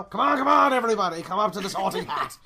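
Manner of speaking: loud, fast host patter; game show host. Retold or imitated. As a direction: hype the crowd like a TV host. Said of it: "talks like a game show host now".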